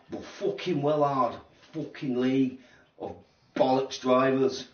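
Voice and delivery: put-on slow, drawn-out hard-man British accent. To stream chat, a hammed-up British accent. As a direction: lean into a tough British accent. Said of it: "Sorry about the accent".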